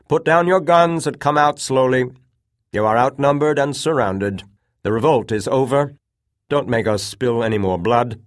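loud, high ringing order; shouting command. A narrator's reading. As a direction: call them out to surrender. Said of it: "he shouted".

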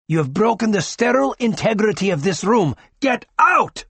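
rising to a high, loud furious demand; doctor ordering gunmen out of the delivery room; indignant order. A narrator's reading. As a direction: drive them out of the room.

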